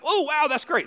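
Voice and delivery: high-pitched mock admiration; acted-out wowed onlooker; impressed cheer. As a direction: marvel at a lucky shot.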